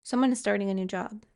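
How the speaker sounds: fast, offhand repeat of the line; quick restatement. Real, not acted. Tarot reader quickly repeating the message to viewers. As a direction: rattle it off as a quick recap.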